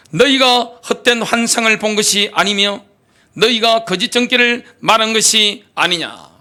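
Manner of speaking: usual speaking voice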